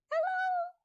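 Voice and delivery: very high squeaky "Hello"; squeaky mouse "Hello" in a kids' song. Shown as a demonstration. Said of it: "as high as we can".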